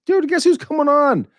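high, loud, drawn-out hype; ecstatic, stretched-out shout of the guest's name; excited announcing. Real, not acted. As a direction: announce the guest like a big reveal.

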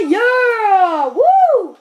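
high, loud whoop of joy; cheering goal. Real, not acted. Gamer cheering his goal in a loud whoop. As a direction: celebrate the goal at full voice.

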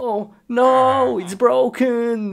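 high, loud oh no; dismayed cry. Real, not acted. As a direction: cry out as it breaks. Computer breaks again.

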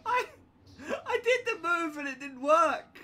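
said through high laughter; laughing. Real, not acted. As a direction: laugh the excuse out.

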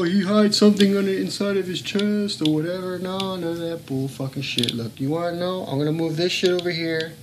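ordinary talk

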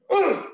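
high, loud straining grunt; squeeze grunt. Acted. Bear squeezing the bird, a loud straining grunt. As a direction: grunt as you squeeze hard.

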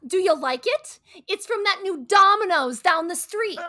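presents the pizza loudly and proudly; proud loud announcement. Acted. Wife presenting rival pizza to husband, loud and proud. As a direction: beams it out at full volume.

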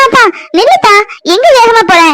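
calling out. Acted. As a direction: shout after him to wait. Calling after him as he hurries.

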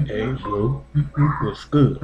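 low, hushed imitation of street talk; mock whispering. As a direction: mimic them, very soft and low. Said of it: "always whispering when they talk".

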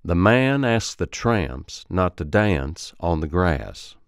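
slow Alabama accent, stretched two-stage A vowels; Alabama accent drill. Shown as a demonstration. Coach modelling for students to repeat, slow Alabama accent. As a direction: draw out each A into an Alabama diphthong. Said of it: "So repeat after me".

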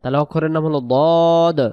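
ordinary delivery

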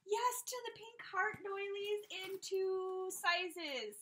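high, loud, drawn-out gush; thrilled approval. Real, not acted. Yes to the pink heart doilies, thrilled. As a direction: stretch it out in high delight.

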